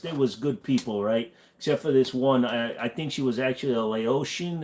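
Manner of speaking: put-on a New York wise-guy accent, fairly light. A New York guy telling a friend about his cousin's truck, New York accent. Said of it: "That's a solid New York accent".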